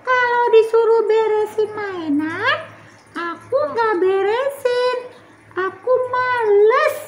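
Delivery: put-on high childish voice; little girl Tiur. Tiur admitting she talks back, in a high child voice. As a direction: confess it like a naughty little kid. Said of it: "Ngelawannya apa aja?".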